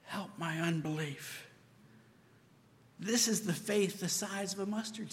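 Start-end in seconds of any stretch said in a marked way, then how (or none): 0.0-1.5 hushed plea. Retold or imitated.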